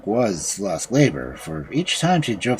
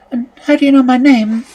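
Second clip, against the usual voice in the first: put-on girl asking; 13 semitones higher, 8 dB louder, faster than usual. A narrator's reading. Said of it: "she asked".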